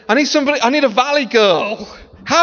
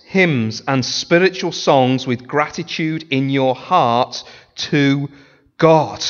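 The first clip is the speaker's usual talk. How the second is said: slow, measured Bible reading; solemn slow reading of Colossians; scripture reading; 3 semitones lower, slower than usual. Acted. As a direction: read the verse with weight. Reads scripture.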